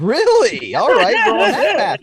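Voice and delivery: loud, high-pitched mock outrage; indignant retort. Telling friends how he took on the rude caller, loudly indignant. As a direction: rise into a loud, indignant challenge. Retold quarrel with call center caller.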